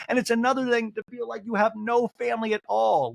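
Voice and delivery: high, plaintive upset impression; mock tearful. Castmate crying about her mom in a talking head, mock tearful. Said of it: "starts to cry in the talking head".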